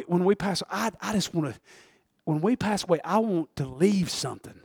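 ordinary talk, nothing marked

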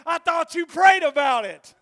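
a shouted echo of the wife; wife's retort. Retold or imitated.

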